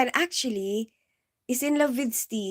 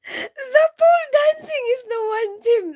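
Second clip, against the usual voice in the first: laughing to tears; 12 semitones higher, 7 dB louder than usual. Real, not acted. Telling Tim about the video, laughing to tears. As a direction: squeeze the words out between laughs.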